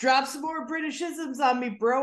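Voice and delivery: high, hammed-up taunting; mock challenge. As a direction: egg them on in a high, playful squawk. Mock challenge in the fake-accent character.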